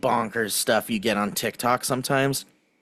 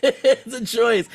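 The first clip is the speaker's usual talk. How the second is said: higher, slow mock-posh repeat through laughter; laughing put-on; 8 semitones higher, slower than usual. Real, not acted. Repeating the friend's joke line, higher put-on laughing voice. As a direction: lift it into a silly put-on voice, laughing.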